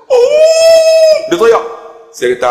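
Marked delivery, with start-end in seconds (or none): 0.1-1.2 long, very high, loud "oh" howl; hammed-up sustained sob-wail; mock crying wail. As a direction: imitate a man bawling on your shoulder. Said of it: "dia teriak atas bau saya".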